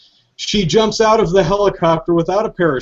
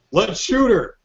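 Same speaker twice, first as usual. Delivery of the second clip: mock second guard; 7 semitones higher, slower than usual. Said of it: "And another guy goes".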